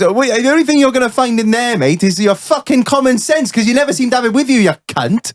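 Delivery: put-on high, quick Welsh accent, scornful. Welshman mocking his mate rummaging in the toolbox, Welsh accent. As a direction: rattle off the insult as a sarcastic Welshman. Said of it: "I'll do my best Welsh accent".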